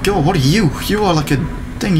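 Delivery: ordinary talk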